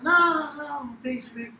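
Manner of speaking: high, loud Italian protest; excited Italian waiter. Retold or imitated. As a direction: wave it away like a Roman waiter. Italian waiter denying, exasperated.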